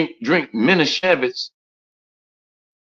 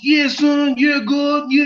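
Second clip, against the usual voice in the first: much higher, louder East Coast street voice; East Coast slang; 14 semitones higher, 4 dB louder, slower than usual. Retold or imitated. Imitating East Coast speech to show dialects, in a high, loud New York voice. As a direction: toss it off like a kid from New York. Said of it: "You go to the East Coast".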